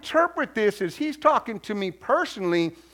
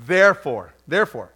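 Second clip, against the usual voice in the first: put-on playful Southern accent warm-up; 5 semitones lower, 6 dB louder than usual. Preacher practising for his Southern translation, Southern accent. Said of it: "bust out a little bit of Southern talk".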